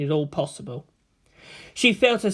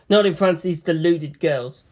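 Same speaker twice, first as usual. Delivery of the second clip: ordinary delivery, nothing marked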